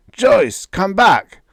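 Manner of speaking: high, loud plea fading to breath; desperate call. A narrator's reading. Father begging his daughter to come back, loud. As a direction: call out, then break.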